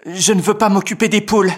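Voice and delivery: the big brother refusing the chore; sulky older boy. Acted. Félix voiced, boy refusing.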